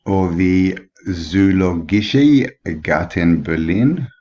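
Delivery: slow, hammed-up German accent; mock German accent, drawn out slowly; horrible German accent. Shown as a demonstration. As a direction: ham up a bad German accent on the name. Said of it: "that was a horrible, horrible German accent".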